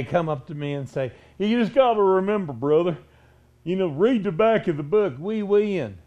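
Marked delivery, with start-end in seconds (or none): 1.4-6.0 a hint of a hearty glad-hander, louder; a mild mock of a cheery grinning churchgoer; backslapping grinning guy. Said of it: "backslapping, you know, cap tooth grinning guy".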